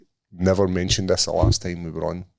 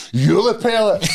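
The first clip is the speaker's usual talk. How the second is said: high, loud, laughing mimic of the fan; hammed-up taunt of a sore loser; mocking the fan; 12 semitones higher, 5 dB louder, slower than usual. Fan's parting insult, mimicked.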